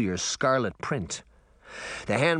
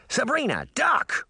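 shouted warning; 13 semitones higher than usual. A narrator's reading. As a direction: yell it to save her. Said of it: "Puck shouted".

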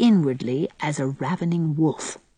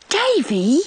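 put-on higher shocked little girl's cry of his name; 8 semitones higher than usual. A narrator's reading. Sister horrified at her brother's blasphemy, shocked high cry. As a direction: gasp his name in horror. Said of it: "said Dora".

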